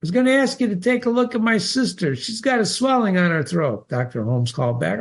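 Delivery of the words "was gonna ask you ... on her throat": Alex's voice. Acted. Alex calling to the doctor.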